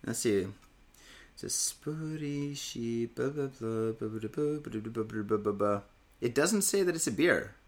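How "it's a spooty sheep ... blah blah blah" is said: mumbled label reading. Real, not acted. Reading can label to himself.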